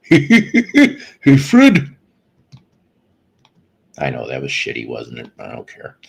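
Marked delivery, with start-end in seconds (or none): 0.1-1.9 very high, loud giggle into a greeting; hammed-up cartoon impression, high and loud; Barney Rubble. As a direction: squeal it out as a giggly cartoon sidekick. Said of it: "we just need Barney and Betty".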